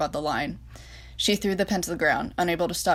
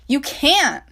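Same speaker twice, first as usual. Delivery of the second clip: loud, high cry of protest; shouted protest; 9 semitones higher, 8 dB louder than usual. Acted. France shouting her protest at Reich. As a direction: shout it out in disbelief.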